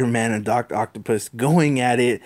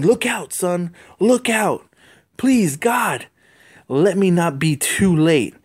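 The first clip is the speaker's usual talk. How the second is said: much higher, louder cry, slowing on look out; desperate warning shout; 7 semitones higher, 3 dB louder, slower than usual. Acted. Captain Stacy shouts to the little boy under the rubble, desperate. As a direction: yell to the boy to get clear.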